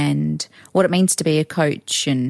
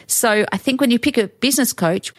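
ordinary delivery

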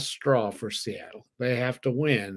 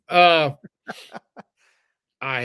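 laughing; 5 semitones higher, 8 dB louder than usual. Real, not acted. Laughs at his own cluelessness.

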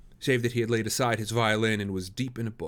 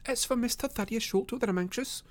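put-on high young lady voice, restrained; Miss Morstan, anxious; 13 semitones higher than usual. Lady voices concern for an accused man, high voice. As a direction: keep it high without raising volume.

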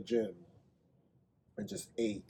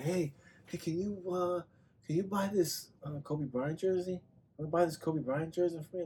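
higher voice, a little softer; imitated street pitch; 6 semitones higher, 4 dB quieter than usual. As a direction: approach a stranger with a tentative sales pitch. Voicing a man pitching strangers.

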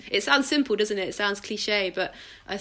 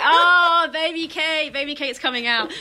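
high, loud and laughing, gleefully teasing; husband delighted, laughing at his wife's softened voice; 8 semitones higher, 7 dB louder than usual. Retold or imitated. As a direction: tease her like you caught baby Kate out. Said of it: "And he'll be like".

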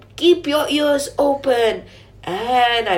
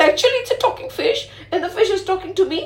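amazed; 7 semitones higher, much faster than usual. Acted. King amazed, laughing in disbelief.

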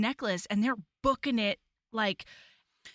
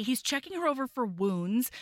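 plain reading voice

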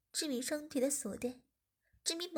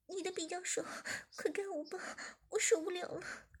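higher, hurried apology; apologetic woman; 6 semitones higher than usual. A narrator's reading. Zhiming begs the boss, higher voice. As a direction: beg for another chance.

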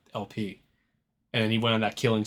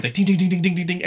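imitated ricochet dings; 6 semitones higher, 3 dB louder than usual.

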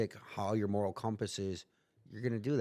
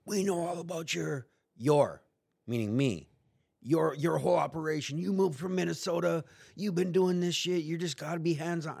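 higher, louder hard-nosed cop voice, imitated; threatening young cop; 8 semitones higher, 4 dB louder than usual. Young cop grilling the arrested narrator, higher mock tough-cop voice. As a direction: play the young cop leaning on the suspect, pushy and loud.

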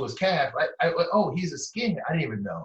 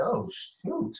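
startled; 10 semitones higher, 3 dB quieter than usual. Retold or imitated. Hearing the neo-Nazi's remark.

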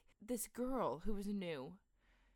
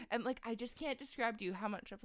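usual speaking voice

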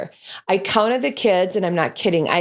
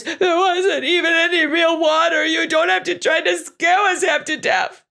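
put-on a little boy wailing through tears; a three-year-old sobbing out a complaint; crying child; 9 semitones higher than usual. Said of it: "Spike was saying through his tears".

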